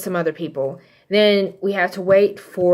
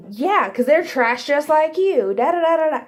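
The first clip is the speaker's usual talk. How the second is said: higher-pitched retold insult; mock scornful retort; 8 semitones higher than usual. Husband insults the rival over roses, mock scornful. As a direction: throw it back, imitating a quarrel.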